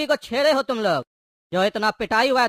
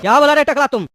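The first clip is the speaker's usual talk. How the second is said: menacing challenge; 4 semitones higher, 5 dB louder than usual. Acted. Thug challenges the insult.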